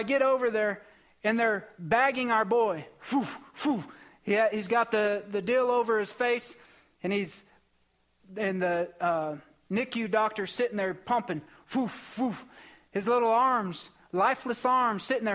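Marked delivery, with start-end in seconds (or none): 3.1-3.8 bag pumping sound. Retold or imitated. Mimics the breathing bag pumping. 11.7-12.4 bag pumping sound. Retold or imitated. Mimics the breathing bag pumping.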